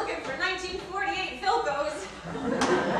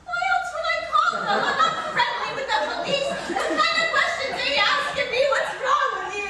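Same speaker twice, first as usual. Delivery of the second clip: much higher and louder, racing at first; hysterical; 8 semitones higher, 4 dB louder than usual. Acted. Wife snapping at husband after the robbery, hysterical. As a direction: spiral up in indignant alarm. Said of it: "because you're hysterical".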